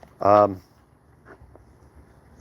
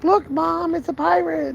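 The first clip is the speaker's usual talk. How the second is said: put-on much higher, child calling out; excited kid voice; slower than usual. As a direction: squeal it like a small kid. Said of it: "when the kids are in the store like".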